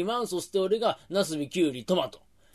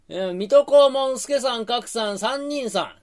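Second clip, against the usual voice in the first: proud recital; 6 semitones higher, 4 dB louder than usual. Acted. Reciting his word proudly.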